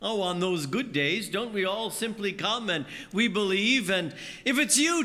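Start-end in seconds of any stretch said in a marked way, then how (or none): 3.9-5.1 high, bright-eyed eager plea; eager faith. Acted. A believer on a good day, eagerly sure of faith.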